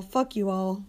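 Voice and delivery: ordinary talk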